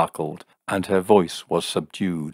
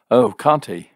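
louder, higher indignant demand; furious; 4 semitones higher, 7 dB louder than usual. A narrator's reading. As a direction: throw the challenge back angrily. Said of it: "demanded Jim, quite furious".

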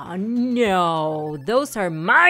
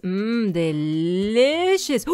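ordinary talk, nothing marked

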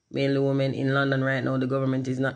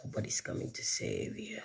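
ominous whispered voiceover; apocalyptic intro voice; 6 semitones higher, 14 dB quieter than usual. Acted. Streamer showing off voiceover skills, whispered apocalyptic intro. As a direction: whisper it like a doom-laden film intro. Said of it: "intro voice there".

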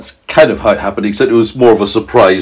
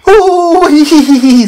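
high tickled giggle; giggling meteorite; 5 dB louder, slower than usual. A narrator's reading. Meteorite being touched, high giggles. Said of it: "said the meteorite".

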